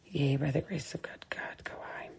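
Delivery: breathy whispered repeat. Real, not acted. Repeating the blessing to herself, whispered. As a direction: whisper the line again. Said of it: "whispered repeat".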